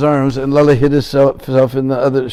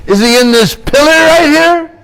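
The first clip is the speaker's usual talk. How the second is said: much higher, loud, a peak of rage; furious roar; 12 semitones higher, 6 dB louder than usual. Acted. Demon king screaming at the pillar before smashing it, furious.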